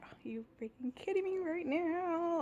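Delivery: high, drawn-out exasperation; mad disbelief, retold. Seeing the computer pulled from the drawer, retold exasperation. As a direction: drag it out in mock outrage. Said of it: "I was just so, like, mad".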